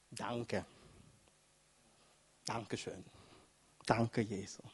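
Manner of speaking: very soft, low, humble thanks; hushed gratitude. Acted. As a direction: offer each thanks softly, with pauses. Healed man humbly thanking Jesus.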